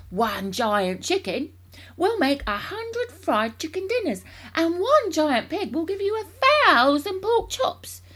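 soaring high, loud enthusiasm; excited cry. A narrator's reading. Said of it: "cried Mister Cranky, waving his arms".

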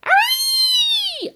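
very high, loud whoop; loud shrill hooray; yelled. A narrator's reading. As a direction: shriek it with wild joy. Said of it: "Grandma yelled".

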